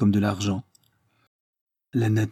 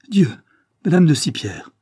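a sudden cry of shock, raised and louder; startled exclamation; 6 semitones higher, 7 dB louder than usual. A narrator's reading. Morlaix sees Sipierre enter, startled cry. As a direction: gasp out the name as she appears unannounced. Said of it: "fit madame de la Morlaix".

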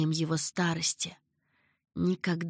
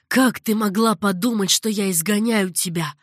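much louder cry of disbelief; shouted out; 8 dB louder than usual. A narrator's reading. The caliph cries out to Zeinab, loud and hurt. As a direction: burst out in hurt protest. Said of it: "выкрикнул он".

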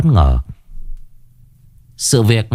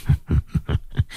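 a soft unvoiced villain's snicker; quiet sinister laugh; breathy evil chuckle; 6 semitones lower, 8 dB quieter than usual. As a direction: chuckle under your breath with malice. Sorcerer gloats after threat.

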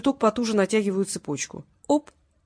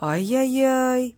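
slow, drawn-out higher tut-tut; sing-song chiding, louder held vowels; mock reproach; 5 semitones higher, 3 dB louder, much slower than usual. A narrator's reading. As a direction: cut in with a teasing scolding. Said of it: "перебил его Васильев".

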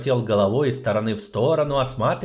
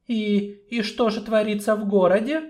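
frightened question; 12 semitones higher than usual. Acted. Sorceress frightened.

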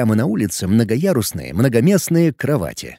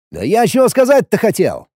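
loud; 7 semitones higher, 3 dB louder than usual. Acted. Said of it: "громко проговорил я".

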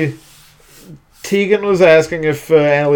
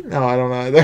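ordinary delivery

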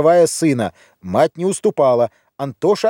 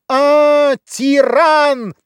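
very high, loud shrieked accusation; squeal; 12 semitones higher, 4 dB louder, much slower than usual. A narrator's reading. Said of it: "взвизгнула Юлия". (пронзительный визг)

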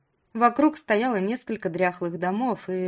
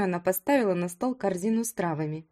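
usual speaking voice

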